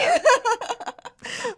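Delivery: high, pleased burst of laughter; delighted laugh. Real, not acted. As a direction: laugh it off, flattered. Laughing at the compliment.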